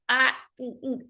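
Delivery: acted-out reluctant refusal noises; resistant grunts. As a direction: balk with little wordless grunts of reluctance. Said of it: "sometimes I'm resistant to using".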